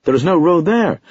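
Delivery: emphatic outburst. A narrator's reading. Narrator insisting to sergeant he is no fool, loud emphatic cry. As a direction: shout "There is no road there" with rising exasperation. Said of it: "I cried excitedly".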